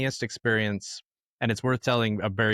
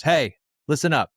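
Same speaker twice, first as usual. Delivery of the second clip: mock berating, louder and higher; a raised, mock-scolding callout; berating the crowd; 4 semitones higher, 6 dB louder than usual. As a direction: imitate a performer calling a crowd to order. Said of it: "just starts berating the crowd".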